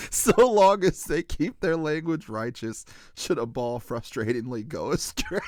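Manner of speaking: high, loud reading through real laughter; laughing while reading. Real, not acted. Host reading a funny line to co-host, laughing. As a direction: read it on the edge of cracking up.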